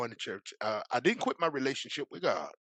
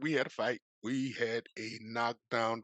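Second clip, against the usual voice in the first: ordinary talk, nothing marked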